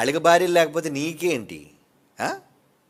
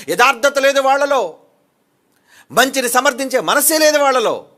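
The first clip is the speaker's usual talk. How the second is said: preaching at full force; 9 semitones higher, 10 dB louder than usual. Acted. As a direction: thunder it from the pulpit. (ఉగ్రంగా బిగ్గరగా ప్రసంగం)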